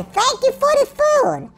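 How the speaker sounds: fake-polite gratitude as a gloat; mock sweet thanks. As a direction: thank them sweetly after taking their food. Mocking the robbed player.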